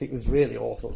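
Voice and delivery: ordinary delivery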